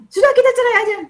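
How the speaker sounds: high and loud, fed-up mock quit; exasperated "just divorce" outburst. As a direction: blurt it out like a spouse giving up. Spouse voiced quitting marriage.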